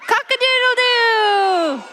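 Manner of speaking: high, drawn-out rooster crow. Shown as a demonstration. Teacher leads the children in a rooster crow. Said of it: "Can you shout?".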